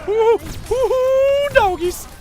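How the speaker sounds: very high, loud holler; whooping cheer. Real, not acted. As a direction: let out a wild celebratory whoop. Whooping over game action.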